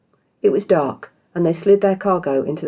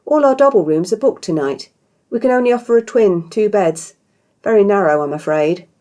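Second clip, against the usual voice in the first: higher, louder, eager-to-please hostess; polite innkeeper; 5 semitones higher, 6 dB louder than usual. Acted. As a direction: apologize brightly to a guest. Innkeeper Isha's voice.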